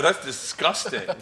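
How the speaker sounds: slow mock disgust, laughing; appalled dad. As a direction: draw it out, appalled but amused. Retold appalled dad reacting to pun.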